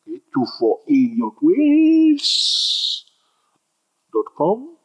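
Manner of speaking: high, slow, drawn-out website name; slow emphatic address. Shown as a demonstration. Teacher tells confused viewers his website, slow and high. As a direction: stretch it out high so they remember it.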